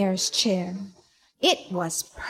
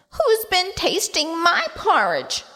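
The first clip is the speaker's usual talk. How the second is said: high squeaky little bear voice; squeaky Baby Bear; 8 semitones higher than usual. A narrator's reading. Baby Bear squeaks about his porridge, high. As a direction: squeak it like a tiny cub. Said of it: "squeaked Baby Bear".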